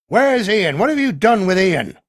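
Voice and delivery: a suspicious villager demands where Ian is; angry local demanding answers. A narrator's reading. Drunk local shouts at the colonel, accusing.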